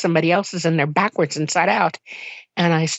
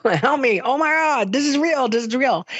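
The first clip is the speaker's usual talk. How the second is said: high, overexcited retold outburst; emotionally freaking out; 7 semitones higher than usual. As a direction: relive the frantic joy, pitched high. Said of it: "I was emotionally freaking out".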